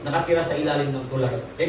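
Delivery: usual speaking voice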